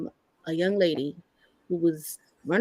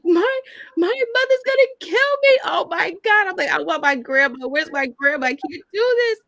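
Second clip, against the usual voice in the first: high, loud panicky voice reliving the shock; panicked retelling; 11 semitones higher, 3 dB louder than usual. Retold or imitated. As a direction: replay the scared pregnant teen begging for grandma. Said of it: "I cried".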